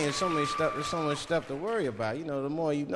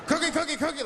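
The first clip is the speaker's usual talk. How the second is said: Cookie Monster; 11 semitones higher than usual. Retold or imitated. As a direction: scream it like a crazed monster.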